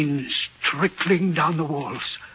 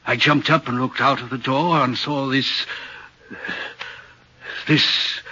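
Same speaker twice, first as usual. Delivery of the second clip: ordinary talk, nothing marked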